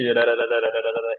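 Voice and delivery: রা repeated as a quick tapped ra sound; flap t drill. Shown as a demonstration. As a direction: drill রা রা over and over, tongue flapping. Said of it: "আবারও বলি".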